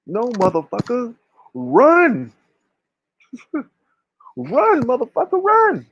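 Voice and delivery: high, urgent yell to run; urging yell. Pretend. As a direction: shout them into running. Yelling at own people to run.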